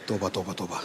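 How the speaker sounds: low, soft dismayed murmur; horrified murmur. Real, not acted. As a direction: mutter it in disbelief. Shocked by image.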